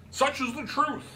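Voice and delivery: high, grand and declaiming; pompous club president holding forth; pompous gentleman voice. A narrator's reading. Root voice again.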